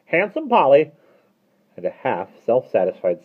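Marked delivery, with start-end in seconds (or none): 0.1-0.9 high, loud parrot cry; parrot squawk. A narrator's reading. Parrot head having opened the tin, squawking. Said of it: "squawked the parrot head".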